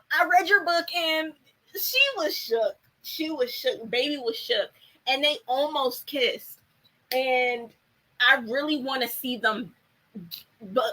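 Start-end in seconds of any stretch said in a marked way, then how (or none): none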